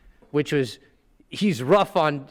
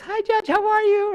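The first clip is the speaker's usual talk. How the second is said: much higher, chirpy hello to the judge; chirpy greeting. Retold or imitated. Trainer greets the feared judge, chirpy and high. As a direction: chirp it up high like a nervous junior lawyer. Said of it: "She goes".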